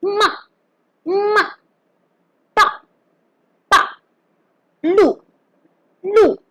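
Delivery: MA, PA, LU each short, dropping from high; falling fourth tone. Shown as a demonstration. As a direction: start "ma", "pa", "lu" high and throw them down. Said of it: "also known as the falling tone".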